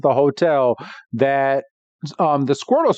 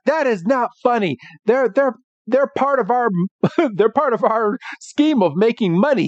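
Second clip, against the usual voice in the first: raised-pitch mock indignation; lightly acted, higher-voiced protest; Jesse; 7 semitones higher than usual. Jesse scolds James over the scheme, mock indignant. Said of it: "Jesse's like".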